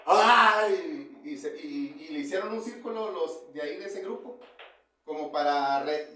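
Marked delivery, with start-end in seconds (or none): 0.1-1.2 loud mock cry of a possessed man; demon's scream. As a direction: yell out "¡Ay!" like the demon. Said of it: "a gritar". (grito fingido del demonio)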